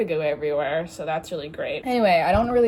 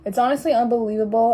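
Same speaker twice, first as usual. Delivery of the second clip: quick, lifted, indignant repeat; emphatic disbelief; 3 semitones higher, 4 dB louder, faster than usual. Real, not acted. Vlogger ranting about pretty girls' modesty, emphatic disbelief. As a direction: push it out with more force.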